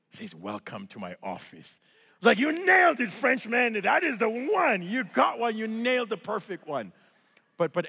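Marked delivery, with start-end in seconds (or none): 0.3-1.8 low, soft, smooth delivery; understated cool welcome. Retold or imitated. Instructor showing off the sky to his student, understated. 2.4-6.9 high, loud, excited cheering; elated congratulation. Retold or imitated. Jumper congratulating the instructor on his line, elated.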